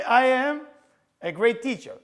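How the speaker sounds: loud, high, emphatic self-affirmation; demonstrated proud declaration. Shown as a demonstration. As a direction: proclaim it proudly, as a model. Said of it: "you start like this".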